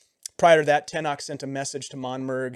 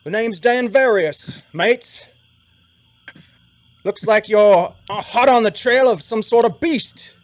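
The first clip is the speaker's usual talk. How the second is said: high, loud Aussie-style Siluese drawl; Siluese accent; 7 semitones higher, 11 dB louder than usual. Acted. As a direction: play the Siluese guide, hearty and loud. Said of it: "that's my interpretation of a Siluese accent".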